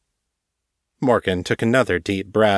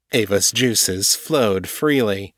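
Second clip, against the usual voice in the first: ordinary delivery, nothing marked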